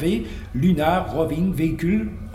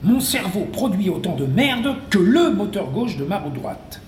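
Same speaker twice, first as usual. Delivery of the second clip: normal talking voice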